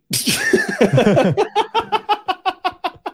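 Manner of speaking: high-pitched cackling laugh; long giggling fit. Real, not acted. Laughs at Ted idea.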